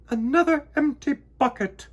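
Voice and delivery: high, loud, fussing queen; queen bee fussing at a clumsy worker; fussy queen bee. A narrator's reading. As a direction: scold the bee for his empty bucket. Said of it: "Queen Bee would fuss".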